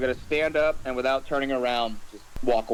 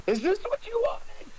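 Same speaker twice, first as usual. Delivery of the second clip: raised, anguished question to the betrayer; hurt accusation. Acted. To Clevis among burning bodies, anguished. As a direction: demand it through hurt. Player voices character confronting NPC.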